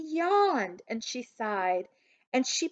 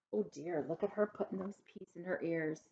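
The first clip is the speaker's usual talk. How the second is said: soft aside; 2 semitones lower, 10 dB quieter, faster than usual. Real, not acted. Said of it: "reader aside to children".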